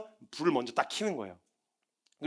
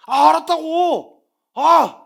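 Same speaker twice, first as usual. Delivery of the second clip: loud, high, slow irritated "알았다고"; annoyed yell; 8 semitones higher, 10 dB louder, much slower than usual. Retold or imitated. Son yelling at his parent to stop waking him. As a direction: yell it out, fed up. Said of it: "어떤 액션도 없고".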